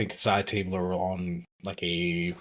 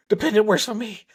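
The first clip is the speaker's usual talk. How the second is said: gloating; 14 semitones higher, 3 dB louder than usual. Retold or imitated. Character gloating in retold story.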